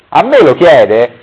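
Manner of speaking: loud outraged cry; yelled. Acted. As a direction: shout it back at the questioner.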